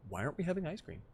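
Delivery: dog's thoughts voiced.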